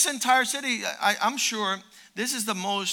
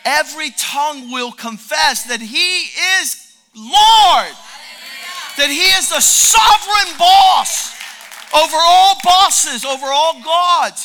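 slow, much louder, proclaiming; preaching shout; 3 semitones higher, 11 dB louder, slower than usual. Real, not acted. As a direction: thunder it over the congregation. Preaching climax.

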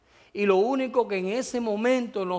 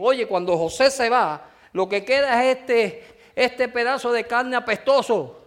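rising, louder preacher's cry; fervent preaching; 5 semitones higher, 4 dB louder than usual. Acted. Pastor rousing the congregation about death, fervent preaching. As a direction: preach it harder line by line. Preacher building to full force.

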